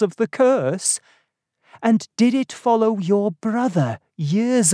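worried Dunning, voice lifted as the questions mount; worried questioning. A narrator's reading. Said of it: "Dunning was still worried".

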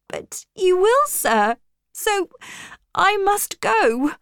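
high, urgent protest; pitched high and pressing, upset; distressed insistence. A narrator's reading. Jane insists to Rochester that she must go, distressed.

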